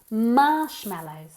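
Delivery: "Marshmallows" slow, with MARSH stretched out; stress on first syllable. Shown as a demonstration. Teacher drills marshmallows with students, first syllable stressed. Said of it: "Emphasis on the first syllable".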